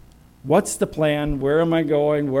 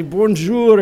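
French greeting; 6 semitones higher, 9 dB louder than usual. Retold or imitated. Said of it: "They would say".

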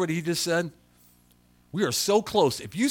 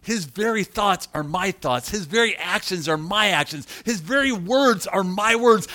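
building, louder preaching; 6 semitones higher, 7 dB louder than usual. Acted. Pastor preaching Christ's unity to the church, building. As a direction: drive each line harder.

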